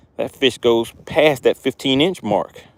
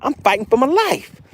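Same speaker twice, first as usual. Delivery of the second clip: high, hammed-up fighting fish; 10 semitones higher than usual. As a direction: squeak it out like a fish fighting for its life. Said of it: "The fish is like".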